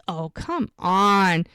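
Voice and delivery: slow, drawn-out teasing oh come on; mock-knowing friend ribbing her. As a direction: tease her like you know better. Teasing her about a crush.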